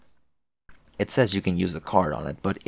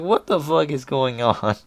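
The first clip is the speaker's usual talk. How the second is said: slow, higher, baffled mock dad voice; bewildered dad; 6 semitones higher than usual. Voicing the puzzled dad in the hallway, slow and baffled. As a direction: puzzle over it like a confused father. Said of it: "Just like".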